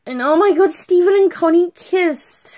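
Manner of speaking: high, loud fangirl squeal; excited squeal. Real, not acted. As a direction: squeal with delight over the kiss. Fan excitement over the kiss.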